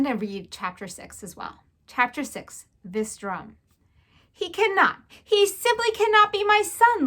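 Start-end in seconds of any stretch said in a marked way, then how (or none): 4.3-7.1 higher, louder fatherly despair. A narrator's reading. Lester refuses to believe the mouse is his son, loud despair. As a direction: protest as if the world is ending. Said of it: "Lester said".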